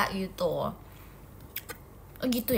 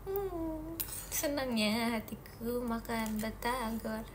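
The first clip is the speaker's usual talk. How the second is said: content murmur; 11 dB quieter, much slower than usual. Real, not acted. Happy murmur while eating.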